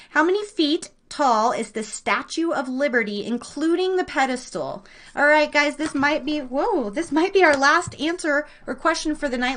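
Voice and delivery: normal talking voice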